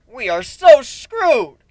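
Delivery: loud, high, slow drawn-out panic; panicked. Acted. Griffin under attack by the possessed pony, loud panic. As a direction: wail it as explosions hit. Spheres exploding around them.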